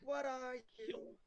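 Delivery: high, drawn-out echo of a yell; mock yell. Player echoes Andromeda's yell, high drawn-out mock yell. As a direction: imitate a yell, high and very slow. Echoes the character's yelled question.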